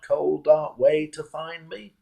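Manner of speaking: normal talking voice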